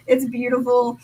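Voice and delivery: ordinary talk, nothing marked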